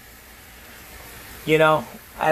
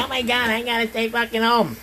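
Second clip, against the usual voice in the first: whining complaint; 4 semitones higher than usual. Retold or imitated. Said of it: "complaining and bitching and whining".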